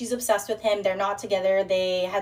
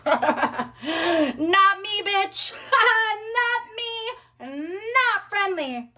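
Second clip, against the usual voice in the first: high, drawn-out singsong taunt; gleeful taunting; 10 semitones higher, much slower than usual. Real, not acted. Woman defying her best friend's stalker, high gleeful taunt. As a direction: taunt in a high voice between laughs.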